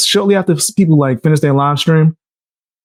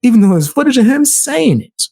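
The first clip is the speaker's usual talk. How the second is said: sarcastic, pitched up high; mock her claim, voice high; high sarcastic outrage; 8 semitones higher than usual. Real, not acted.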